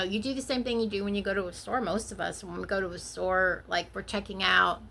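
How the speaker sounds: usual speaking voice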